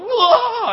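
high ah breaking into a laugh; laughing mock cry. Pastor laughing at his own outburst, high laughing ah. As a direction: replay the cry while laughing at yourself. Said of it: "I'm like".

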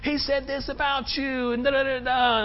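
mock the family vouching for Tobiah; pleading relatives, lightly mocked; gushing relatives. Voices family defending Tobiah.

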